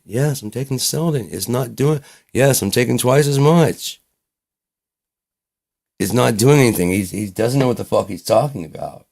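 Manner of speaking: loud, high-pitched protest; exasperated teen. Retold or imitated. Teen argues with parent about useless medicine, exasperated. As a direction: argue back at full tilt, fed up.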